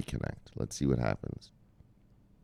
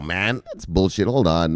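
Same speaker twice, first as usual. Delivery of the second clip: normal talking voice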